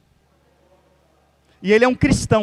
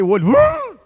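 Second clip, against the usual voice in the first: mock gasp; 10 semitones higher, 4 dB louder than usual. Mimics eyes popping out.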